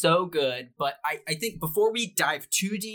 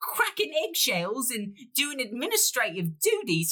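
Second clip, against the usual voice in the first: high put-on voice; 10 semitones higher than usual.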